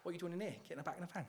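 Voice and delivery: soft, muttered Cockney impression; Cockney accent. Preacher demonstrating how to banish worry, quiet Cockney accent. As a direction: toss it off quietly in mock Cockney. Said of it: "I'll go for the Cockney accent".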